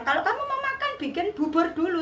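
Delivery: high-pitched voice of the mother; imitating the mother. Mother telling the hungry narrator to wait, imitated high voice. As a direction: pitch it well up as the mother talking. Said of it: "ibunya bilang".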